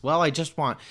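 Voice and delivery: lightly hammed-up Michigan twang; mock Michigan accent. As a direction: mimic an evasive candidate in an overdone Michigan accent. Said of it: "what exactly is she saying of substance".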